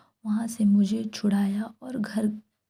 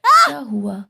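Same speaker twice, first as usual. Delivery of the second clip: sudden loud startled outcry; scared yelp, much louder; frightened cry; 2 semitones higher, 10 dB louder than usual. Retold or imitated. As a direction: blurt it out in fright as your hand is gripped. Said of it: "मेरे मुंह से ज़ोर से आवाज़ निकला".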